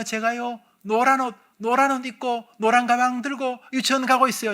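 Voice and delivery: put-on retelling as a little girl in a regression session; little child voice. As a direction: speak as your small child self. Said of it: "이제 목소리 요래, 요래 됩니다".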